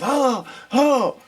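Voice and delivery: high, loud imitated holler; retold yell. Case manager comes out hollering for him, yelling. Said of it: "he comes out there and he yells".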